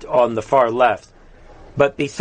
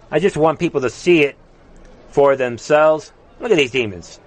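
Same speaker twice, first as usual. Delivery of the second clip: normal talking voice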